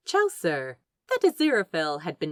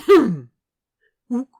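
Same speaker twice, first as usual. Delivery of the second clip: short loud ape hoot; a blunt orangutan grunt; 4 semitones higher, 11 dB louder than usual. Acted. The orangutan answers the angel with a loud ape grunt. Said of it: "came the reply".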